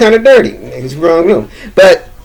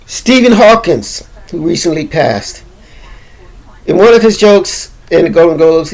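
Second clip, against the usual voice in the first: ordinary talk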